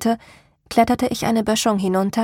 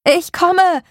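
much higher, loud shout; 9 semitones higher, 7 dB louder than usual. A narrator's reading. Narrator shouts to Martin that help is coming. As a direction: yell back into the forest. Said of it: "schrie ich".

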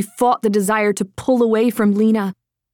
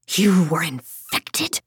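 hissed; 3 semitones lower, slower than usual. A narrator's reading. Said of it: "Quindor hissed between his teeth".

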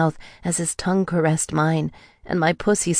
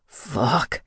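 choked out; 3 dB quieter than usual. A narrator's reading. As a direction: choke the word out. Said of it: "he choked out".

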